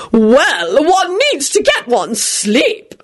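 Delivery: high, slow, drawn-out society lady; fussing lady. A narrator's reading. Lady Fanshawe advising Remus on sleep, fussing. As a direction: stretch it out, high and fussy. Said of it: "Lady Fanshawe immediately began fussing".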